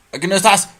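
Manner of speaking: high, loud frustrated shout. Real, not acted. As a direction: yell it at the empty room. Frustrated search in horror game.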